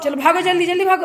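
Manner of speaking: हड़बड़ी में ऊँची आवाज़ में पुकारना; calling out. Real, not acted. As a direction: shout at them to get moving, quickly. Hurrying the kids out.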